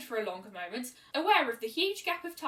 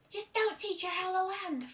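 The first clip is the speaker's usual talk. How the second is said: teasing tomboy voice; 9 semitones higher than usual. A narrator's reading. Rainbow teasing.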